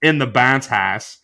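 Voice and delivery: Pittsburgh Yinzer accent, hammed up. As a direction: lean into the mic, Pittsburgh accent. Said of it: "Let me put on my best Yinzer accent".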